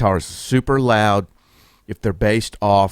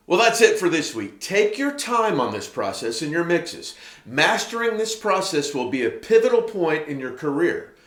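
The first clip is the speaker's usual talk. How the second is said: higher-pitched, lilting sign-off read; presenter's closing read, pitched up; scripted outro read; 6 semitones higher, 5 dB quieter than usual. Acted.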